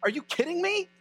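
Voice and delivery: high, disbelieving protest; a flustered cousin who can't believe it; high-pitched, flabbergasted John refusing Jesus; incredulous John the Baptist. Retold or imitated. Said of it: "John the Baptist looks at him and goes".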